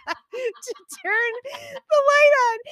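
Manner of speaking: high, helpless laughing, close to tears; can't stop laughing while telling it; laughing through it. Real, not acted. Recounting tripping Beth's husband to co-host, crying with laughter. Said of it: "I'm not crying. I'm laughing".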